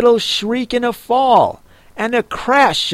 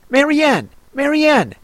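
higher fussy Rabbit calling out; 9 semitones higher, faster than usual. A narrator's reading. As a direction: call for the maid, high and impatient. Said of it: "said the voice".

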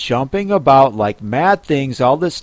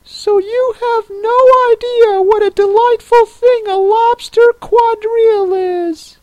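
very high-pitched creature voice; Mock Turtle; 5 dB louder than usual. A narrator's reading. Mock Turtle explains the lobster quadrille to Alice, very high voice.

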